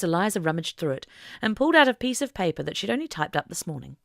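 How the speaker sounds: ordinary delivery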